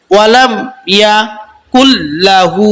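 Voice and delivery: ওয়ালাম ইয়া কুল্লাহু broken into chunks; syllable by syllable. Shown as a demonstration. Teacher breaks the word apart for students, in chunks. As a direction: read it in separate chunks with pauses. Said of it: "প্রথমে আমরা ভাঙ্গে ভাঙ্গে পড়বো".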